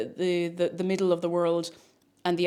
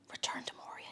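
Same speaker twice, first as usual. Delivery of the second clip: breathy whisper of the name; whispered title; 18 dB quieter than usual. Pretend. Streamer whispers the game title to chat. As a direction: whisper it like a secret.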